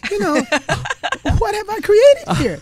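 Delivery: high voice broken by laughter; laughing disbelief. Retold or imitated. Wife laughing about her husband's outburst. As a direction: laugh through the question.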